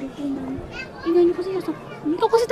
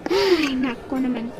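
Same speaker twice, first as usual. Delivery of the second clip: long drawn-out, weary "ay"; slow, lower exasperated groan; 5 semitones lower, much slower than usual. Acted. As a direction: sigh it out, fed up with the kids. Exasperated teacher facing squabbling pupils.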